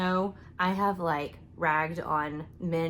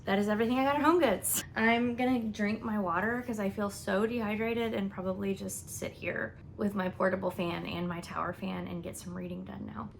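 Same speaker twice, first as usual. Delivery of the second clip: ordinary talk, nothing marked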